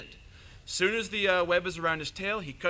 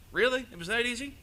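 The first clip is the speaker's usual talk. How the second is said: mock amazement; 7 semitones higher than usual. Anansi amazed at the easy capture.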